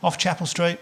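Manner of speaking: normal talking voice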